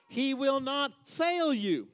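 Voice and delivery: "fail" hit hard and stretched in a high shout; pause, then belt out "fail", slowly; stress on fail. Shown as a demonstration. Preacher re-emphasizing a promise to the congregation, stress on "fail". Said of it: "You can emphasize it any way you want".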